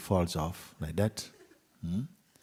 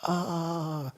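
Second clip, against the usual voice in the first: long high wail of pain; a held, high "ah", acting hurt; mock scream; 11 semitones higher than usual. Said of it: "the whole palace could hear him screaming".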